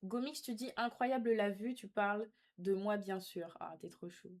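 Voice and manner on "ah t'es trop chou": normal talking voice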